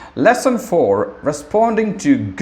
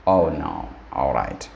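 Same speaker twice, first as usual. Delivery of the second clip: sympathetic; 9 semitones lower, 6 dB quieter than usual. Shown as a demonstration. Teacher demos sympathy.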